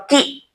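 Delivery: "कि" with a brief, one-beat i; short vowel. Shown as a demonstration. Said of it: "इससे दो गुना ज्यादा time". ("कि" छोटी इ के साथ)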